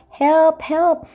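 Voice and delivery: high, pleading little cries; mock howling for help. Reader voicing the howling animal on the card, high mock cries. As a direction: cry out like a stuck animal calling for help. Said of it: "what the dork is howling about".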